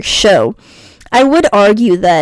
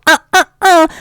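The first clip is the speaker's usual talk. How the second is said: scolding uh uh uh; 8 semitones higher than usual. Real, not acted. Scolding correction noise.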